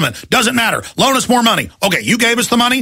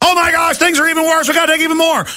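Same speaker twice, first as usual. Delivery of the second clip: mock banker shrieking for more money; whiny panicked banker; 8 semitones higher, faster than usual. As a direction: ham up a desperate banker begging for cash. Mocking bankers begging for bailouts.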